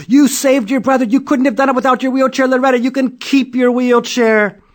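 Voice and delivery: high, loud proud praise; proud father. A narrator's reading. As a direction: gush with pride.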